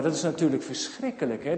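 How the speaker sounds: ordinary delivery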